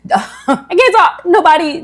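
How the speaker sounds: high, laughing at your own stumble; real laughing. Real, not acted. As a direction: laugh through it as the words trip you up.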